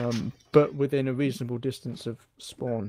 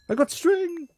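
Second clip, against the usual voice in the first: excited; 14 semitones higher, 4 dB louder than usual. Real, not acted. Excited loot find.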